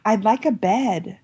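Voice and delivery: slow, lightly voiced child's wish; a hint of a shy-sweet girl, unhurried; smiling little girl. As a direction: say it slowly through a big smile. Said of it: "this big smile on her face".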